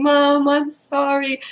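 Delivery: drawn-out, plaintive apology, slightly acted; mild tearful apology. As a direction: lightly imitate a tearful little girl. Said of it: "coming to me with tears and saying".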